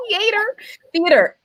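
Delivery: "Theater" said high as THEE-AY-ter; East Coast theater. Shown as a demonstration. Streamer defending her East Coast theater pronunciation. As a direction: stretch "Theater" with a long ay vowel. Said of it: "Not theater".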